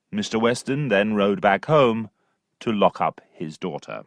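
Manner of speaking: ordinary delivery, nothing marked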